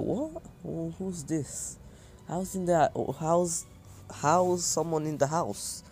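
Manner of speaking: high, startled voice, slowing as she questions; surprised mother hearing an intruder, voice jumping high; startled alarm. Retold or imitated. Anko hears someone in house.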